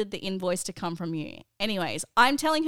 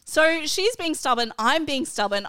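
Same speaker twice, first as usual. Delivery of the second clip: usual speaking voice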